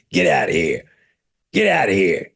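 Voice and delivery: croaky, gruff seal imitation; talking seal voice. Imitating the talking seal answering visitors, gruff croak. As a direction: bark it out like the Boston seal. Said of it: "That's what it sounded like".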